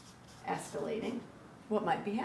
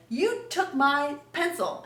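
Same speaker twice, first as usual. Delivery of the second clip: put-on accusing kid; 7 semitones higher, 5 dB louder, slower than usual. Acting out a classroom argument.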